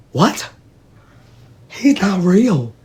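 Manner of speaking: high, baffled "what?" and slow insistence; drawn-out, high-voiced mock bewilderment; incredulous protest. Acts out disbelief at aunt's scolding.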